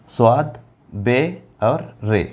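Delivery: each letter name spaced out slowly; slow spelling. Shown as a demonstration. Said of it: "letters spelt out slowly". (अक्षर धीरे-धीरे अलग-अलग बोलना)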